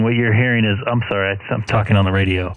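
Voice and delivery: normal talking voice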